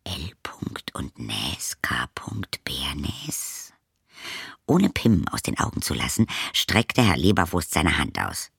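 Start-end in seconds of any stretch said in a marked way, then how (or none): 0.0-3.7 a soft unvoiced whisper of Näs and Bernes; girl whispers the address she recognises. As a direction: breathe the name to herself in surprise. Lilli repeats name under breath.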